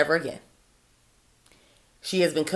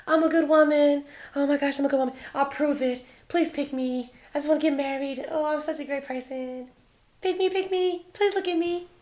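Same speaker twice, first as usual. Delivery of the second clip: much higher, put-on needy girly voice; mocking pick-me; 10 semitones higher than usual. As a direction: beg to be chosen like a caricature. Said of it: "constantly and unconsciously yelling".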